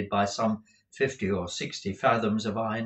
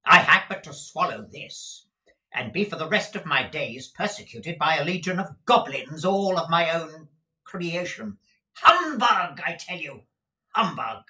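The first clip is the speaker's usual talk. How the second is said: put-on a high, scornful old miser waving the ghost away; scoffing Scrooge; 6 semitones higher, 4 dB louder than usual. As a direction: sneer it off as nonsense, climbing on Humbug.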